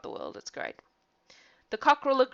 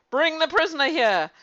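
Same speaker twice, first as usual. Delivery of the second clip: higher, louder call of a judge; 9 semitones higher, 7 dB louder than usual. Acted. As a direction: summon the prisoner loudly. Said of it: "he called out".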